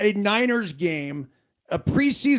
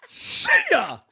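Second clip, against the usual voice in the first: high, louder see ya; gleeful; 6 dB louder than usual. Real, not acted. Host cheers the story's ending, gleefully. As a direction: toss it off with glee.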